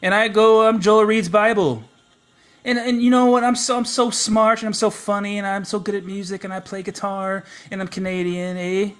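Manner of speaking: high, loud mocking impression of Joel, eh. Streamer mocks his friend Joel, high and loud, mocking. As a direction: ham up Joel as a smug Canadian. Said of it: "look at me, I'm Joel".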